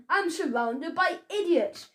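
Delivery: ordinary delivery, nothing marked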